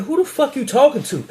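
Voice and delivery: high, sharp mock confrontation; retold angry challenge. Argument starts with robber.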